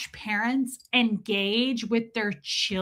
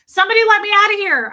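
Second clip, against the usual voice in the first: high, loud and fast panicked cry; panicked plea; 10 semitones higher, 10 dB louder, much faster than usual. Retold or imitated. Trapped at the kids' party, begging out in panic. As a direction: beg to be let out in a rush of panic.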